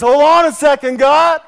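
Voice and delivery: Jesus calling out to halt the judgment; commanding interruption. Acted. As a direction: burst in and stop the gavel. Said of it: "He says".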